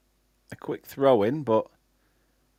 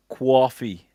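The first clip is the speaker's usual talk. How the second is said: slow, drawn-out New York "coffee"; New York accent. Retold or imitated. Streamer imitating a New York accent for a viewer. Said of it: "You've got the New York one".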